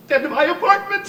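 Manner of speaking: high, loud tearful wail; breaking into sobs. Acted. Divorced father recalling his lost home, breaking down crying. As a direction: choke up and collapse into long sobbing. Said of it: "you mustn't be ashamed to cry".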